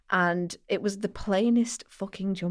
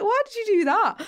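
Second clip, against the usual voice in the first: very high, louder mock outcry; aghast protest; 6 dB louder than usual. Friend appalled he gave out his work email, high aghast protest. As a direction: squeal it in retold dismay.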